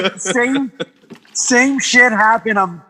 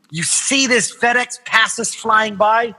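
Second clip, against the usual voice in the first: normal talking voice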